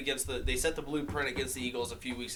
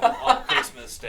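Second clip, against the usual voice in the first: laughing; 14 semitones higher, 9 dB louder, slower than usual. Real, not acted. Laughing through his prediction.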